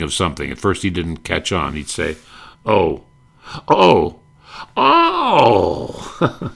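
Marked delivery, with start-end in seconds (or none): 2.7-6.5 each oh rising higher into a cry; dawning realization, climbing pitch; gradual understanding. Retold or imitated. Valet slowly catching on, rising oh by oh. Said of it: "express gradual understanding".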